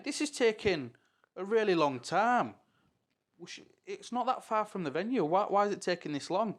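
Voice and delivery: impatient complaint. Retold or imitated. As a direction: replay a baffled back-seat complaint. Acting out his car complaint.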